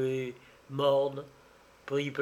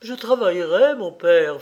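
louder, eager promise; filial fervour; 4 semitones higher, 7 dB louder than usual. A narrator's reading. Daughter vowing to help her father, fervent. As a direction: pledge it with a daughter's ardour. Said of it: "fit Marguerite avec un filial entraînement".

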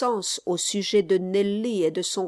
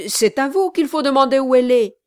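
stammered; 4 semitones higher, 8 dB louder, faster than usual. Acted. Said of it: "le gentleman balbutia".

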